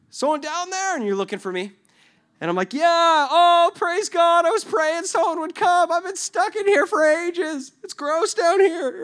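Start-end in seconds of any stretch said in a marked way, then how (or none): 0.1-0.9 high-pitched call down a well. Acted. Rescuer calling to someone in a well, high searching call. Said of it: "And you're just like". 2.7-9.0 high, loud relieved wail; screaming for help. Acted. Man stuck in a well to rescuers, high loud wailing. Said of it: "you hear me screaming".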